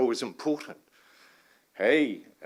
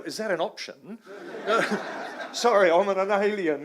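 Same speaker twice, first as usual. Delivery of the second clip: laughing; 8 semitones higher, 5 dB louder than usual. Real, not acted. Retelling his cheeky reply.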